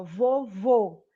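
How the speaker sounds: "vovô" slowly, with closed ô; closed final vowel. Shown as a demonstration. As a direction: draw out "vovô", rounding the stressed last vowel. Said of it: "eu fecho mais a boca pra pronunciar".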